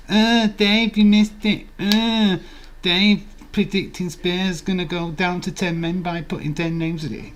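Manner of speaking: high loud taunting call; mocking chat voice. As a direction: jeer at Dave from the chat. Voicing chat taunting Dave.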